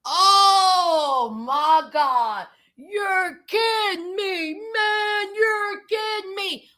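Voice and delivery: badly faked, drawn-out shocked wail; mock shock. J K told about the pregnancy tests, mock shock. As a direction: stretch it into hammed-up disbelief. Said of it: "acted shocked. He was like".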